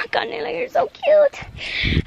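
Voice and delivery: high, drawn-out doting coo; cooing at dog. Real, not acted. Owner to dog Canela on the walk back, cooing. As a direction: coo slowly at an adorable pet.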